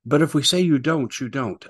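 high, smug mock official voice; high sneering mock tyrant; mocking the rulers. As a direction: lord it over them in falsetto. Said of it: "the Europeans are classic communitarians".

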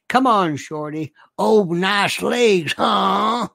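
drawn-out mock Southern accent; hammed-up Southern voice; Southern affectation. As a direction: stretch the vowels like a put-on Southerner. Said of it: "I get to read it in this voice".

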